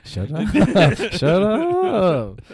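laughing shout of protest; high, loud giggly protest. Real, not acted. Teased, bursts out laughing.

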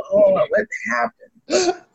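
slow, crying-toned plea; mock distress. Teller in the pew, overwhelmed: slow mock-crying plea. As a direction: wail it half-joking. Sweating in church, in shock.

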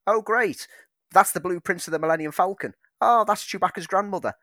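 put-on high, loud gushing fan in a New Zealand accent; excited fan voice. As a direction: gush like a starstruck fan. Mocking fans inside the quote.

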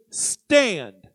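"stand" held long with a falling tune; emphatic "stand". Acted. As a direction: read "stand" drawn out, then let it drop. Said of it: "emphasizing the word stand".

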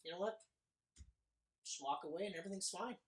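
soft threat sinking to a whisper; villain warning the teen, quiet and menacing; quiet menace. Retold or imitated. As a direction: threaten him calmly, barely audible. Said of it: "And he's like".

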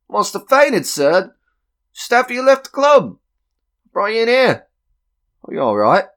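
excitable servant explaining to a dazed gentleman; concerned attendant. Acted. Club attendant to the man who fainted, concerned.

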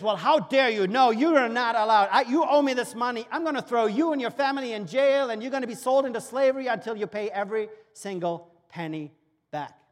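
loud, furious threats; the servant threatens his debtor, loud and furious; angry servant. Acted. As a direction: tear into him with rage. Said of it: "this servant gets angry".

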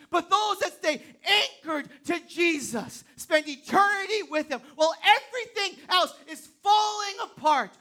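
loud, rising preacher's call; full-force preaching. Acted. Pastor rallying the congregation, loud and driving. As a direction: preach it out to the room.

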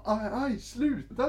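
high, loud yelp of pain; mock pained cry. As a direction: squeal as if being whipped. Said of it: "han bara". (hög, spelad smärta)

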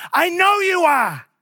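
high, loud, drawn-out retort; forceful preacher's comeback; emphatic shout. Acted. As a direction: shout the answer back, slowly. Shouted reply to acted objection.

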